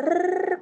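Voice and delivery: high trilled rrr for nonstop talk; comic high rolling trill; rolled-tongue chatter noise. Retold or imitated. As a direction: imitate rapid-fire jabber with a tongue trill. Imitates the woman's nonstop telling.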